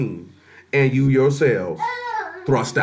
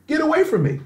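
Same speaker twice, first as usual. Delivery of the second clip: much higher, sharp voiced rejection; stern dismissal; 13 semitones higher than usual. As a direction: cast him away with finality. Final rejection of Esau.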